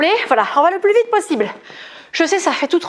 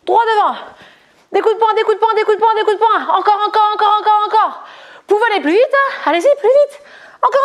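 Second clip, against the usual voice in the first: revved-up coaching; 6 semitones higher than usual. Real, not acted. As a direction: fire them up to go faster. Coach urging faster punches.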